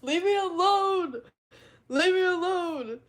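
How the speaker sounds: high, loud, drawn-out mock wail; mock-upset yell. Streamer to chat teasing the accent, mock-upset yell. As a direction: yell it slowly in playful protest.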